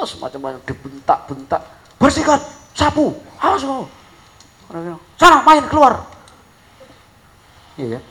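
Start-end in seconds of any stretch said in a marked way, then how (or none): 2.0-6.0 high, loud mock scolding; imitating yelling father. Father yelling at his son to sweep up, mock furious shout. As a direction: bark orders at the child like an enraged dad. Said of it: "Langsung bapaknya bentak-bentak".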